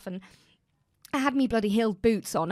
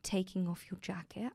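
soft, slow hushed request; whispered politeness; 4 semitones lower, 11 dB quieter than usual. Retold or imitated. Imitating the Danish security woman asking softly. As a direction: gently murmur like a kind official.

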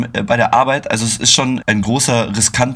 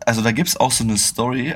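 ordinary delivery

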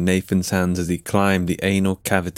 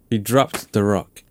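plain reading voice

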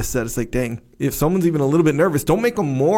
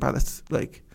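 inner thought; 2 semitones lower, 8 dB quieter than usual. Retold or imitated. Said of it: "I remember thinking".

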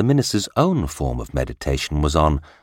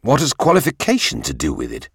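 high-pitched, protesting cry; indignant cry; 7 semitones higher, 4 dB louder than usual. A narrator's reading. King challenges his minister, crying out. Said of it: "cried the king".

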